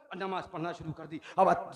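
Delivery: ordinary talk, nothing marked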